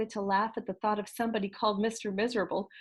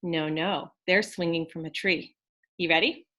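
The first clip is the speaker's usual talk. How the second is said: plain reading voice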